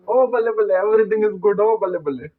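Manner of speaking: carefree cheering at home; happy cheer. Acted. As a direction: sing out the joy lightly. Real king happy at home.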